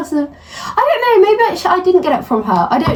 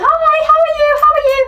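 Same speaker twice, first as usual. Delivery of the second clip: high sing-song greeting, imitated; chirpy mock greeting; 14 semitones higher, much faster than usual. Mimicking a passer-by's overly chirpy hello. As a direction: pitch the hello way up, mocking.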